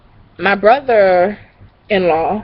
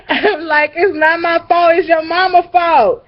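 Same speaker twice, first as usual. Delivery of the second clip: higher, giggly protest; laughing through words; 8 semitones higher than usual. Real, not acted. Blaming the cousin's mom, laughing. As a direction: protest while cracking up. Laughing while quoting self.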